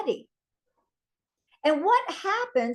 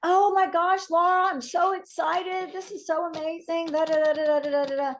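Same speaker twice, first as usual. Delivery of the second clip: gushing customer; 5 semitones higher than usual. Retold or imitated. New customer gushing to Laura about the patches, mock excited. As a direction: bubble over like a thrilled newbie.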